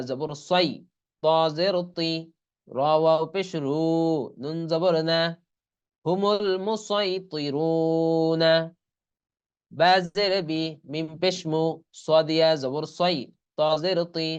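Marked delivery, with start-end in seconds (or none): 6.1-8.5 slow, drawn-out Quran chant; Quranic recitation. Shown as a demonstration. Teacher recites the joined word for the students after spelling. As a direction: recite it slowly and sustain the vowels.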